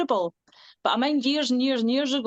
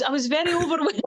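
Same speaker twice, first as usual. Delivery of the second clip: higher, breaking into laughter; laughing admission; 7 semitones higher than usual. Real, not acted. Singer telling interviewer about first big gigs, laughing. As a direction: laugh through the confession. Laughs while admitting being overwhelmed.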